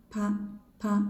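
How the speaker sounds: "Pa" with no puff of breath; unaspirated pa. Shown as a demonstration. Teacher demonstrating unaspirated pa. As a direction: keep the breath in on pa. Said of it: "without breath".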